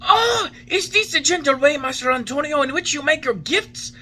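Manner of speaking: put-on old man struck on his thin legs; hurt complaint. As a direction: protest the blow, half laughing bitterly. Geppetto hit by the wood.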